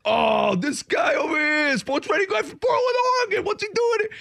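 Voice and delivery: hollering across the course to his buddy Kenny. Retold or imitated. New England golfer acted out.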